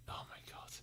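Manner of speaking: breathy whispered oh my god. Real, not acted. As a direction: whisper it in awe. Whispered reaction.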